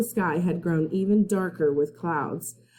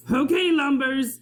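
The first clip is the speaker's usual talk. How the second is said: a captain sneering at his prisoners; pirate captain; 8 semitones higher than usual. Acted. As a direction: snarl it at the prisoners.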